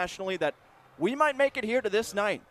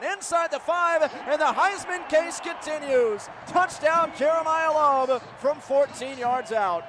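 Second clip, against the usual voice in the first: higher, lifted play-by-play; excited touchdown call; 8 semitones higher, 5 dB louder, slower than usual. Real, not acted. Announcer calling a Jeremiah Love touchdown, excited. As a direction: rise as he crosses the goal line.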